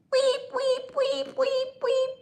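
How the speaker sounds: very high mock censor beep; imitated bleep. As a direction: squeak out a beep tone. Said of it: "like a little technical bleep".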